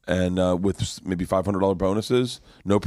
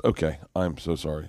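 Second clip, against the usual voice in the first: low, soft mock-apologetic delivery; retold apology; 5 semitones lower, 5 dB quieter than usual. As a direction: imitate an apologetic booker, subdued. Booker apologises for another bump.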